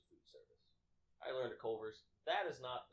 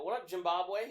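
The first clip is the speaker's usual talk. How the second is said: higher, loud call-out; acted teasing greeting; 9 semitones higher, 11 dB louder than usual. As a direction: holler it as a cheeky greeting. Calling out a teasing nickname.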